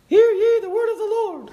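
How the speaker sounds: very high, thin mock prophet voice; squeaky high prophet voice. Preacher mocks how Elisha might have sounded, high squeak. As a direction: play Elisha with a comically high squeak, like Mike Tyson. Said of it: "Does that sound like the prophet?".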